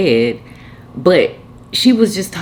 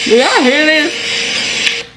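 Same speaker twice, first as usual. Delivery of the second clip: stretched out, much higher, in disbelief; 11 semitones higher, 5 dB louder, much slower than usual. Real, not acted. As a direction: ask the audience in slow amazement. Laughing disbelief to audience.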